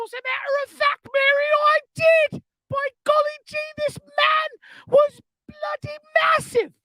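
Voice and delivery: very high-pitched comic English ladies; English accent. Acted. Two ladies gawping at the plant, shrill mock English accent. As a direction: squeal it in a hammed-up English accent. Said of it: "speaking in an English accent".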